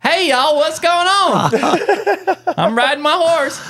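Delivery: high-pitched, drawn-out Southern drawl; Southern kid. Retold or imitated. Imitating himself in childhood videos, high hammed-up Southern accent. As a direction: mimic a little kid's heavy Southern accent. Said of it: "there are videos of me as a kid".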